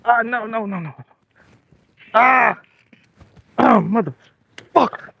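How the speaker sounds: high, drawn-out cries of frustration; real dismay. Real, not acted. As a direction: cry out in dismay as it slips away. Loses a hooked fish.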